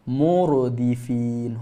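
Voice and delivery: Murdifin stretched out slowly; slow demonstration. Shown as a demonstration. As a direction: hold the ra in Murdifin. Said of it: "Ra mati kat situ".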